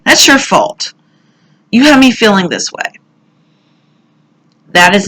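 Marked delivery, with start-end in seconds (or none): 0.0-3.0 retold accusation. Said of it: "I said that this week".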